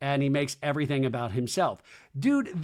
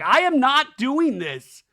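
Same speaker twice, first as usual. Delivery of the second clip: slow, loud, high imitation; mock-furious, drawn-out protest; indignant refusal; 10 semitones higher, 8 dB louder, slower than usual. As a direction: spell out an outraged refusal word by word. Retold refusal to a producer.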